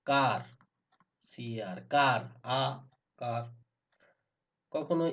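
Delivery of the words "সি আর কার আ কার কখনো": normal talking voice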